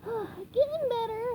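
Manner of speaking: patient encouraging sponge. Acted. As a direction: coax him kindly. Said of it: "SpongeBob said encouragingly".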